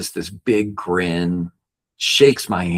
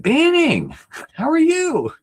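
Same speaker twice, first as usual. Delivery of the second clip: much higher, bright retold dad voice; dad's cheerful greeting; 3 dB louder than usual. Dad picking up the phone to his son, high cheerful voice. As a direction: lightly voice Dad answering, lifted high.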